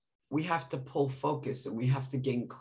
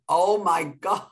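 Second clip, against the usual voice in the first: loud, higher burst breaking into laughter; laughing exclamation; 4 semitones higher, 5 dB louder than usual. Retold or imitated. Nanny recalling her reaction to mom's news, laughing.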